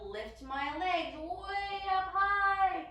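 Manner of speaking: slow, sing-song swoop upward; rising with the lift. Shown as a demonstration. Teacher lifting into downward dog, slow rising sing-song. Lifting hips, voice climbs and stretches.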